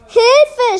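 high-pitched little cry for help; mock scream. A narrator's reading. Class cries for help at a voice, mock scream. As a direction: cry out in pretend fright. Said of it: "schrie die Klasse".

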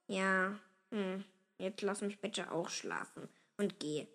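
soft, low drowsy mumbling; drowsy father brushing someone off; sleepy grumble. A narrator's reading. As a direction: mumble as if half asleep. Sleepy father wants to rest.

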